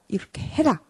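put-on short, decided command to a child; firm and brief. Shown as a demonstration. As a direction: say it once, no room for debate. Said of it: "이렇게 단호하고 간결하게 말해줘야 돼요".

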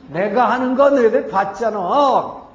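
loud, drawn-out retold anger; angry outburst. Moses strikes the rock before the people, angry outburst. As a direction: imitate a leader losing his temper, stretching the words. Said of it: "화를 팍 낸 거죠".

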